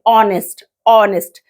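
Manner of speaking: "ऑनेस्ट" with no h sound, opening straight on "o"; "ऑनेस्ट", open vowel first, no h; silent h. Shown as a demonstration. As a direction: drop the h in "ऑनेस्ट". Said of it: "silent होता है एच और ऑनेस्ट बोलते हैं".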